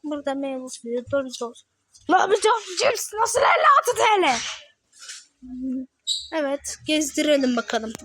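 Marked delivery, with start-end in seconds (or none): 2.1-4.4 loud, high, shocked yell at the price; outraged shout. Real, not acted. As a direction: blurt it out in disbelief, shouting.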